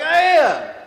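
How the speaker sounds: high, loud retort over the chaos; exasperated full-voiced yell; yelling back. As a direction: shout it back frantically at the commander. Yells back at panicking commander.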